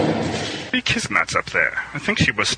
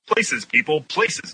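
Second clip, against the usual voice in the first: raised, higher call to order; yelled; 9 semitones higher, slower than usual. A narrator's reading. Director calling performers to places, mild yell. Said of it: "Dan yelled".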